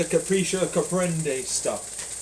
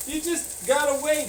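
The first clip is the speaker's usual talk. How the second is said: higher, sing-song advice giver; mock platitude voice; 4 semitones higher, 6 dB quieter than usual. Mocking the platitudes people say, higher. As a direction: parrot the cheap advice lightly. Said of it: "they say".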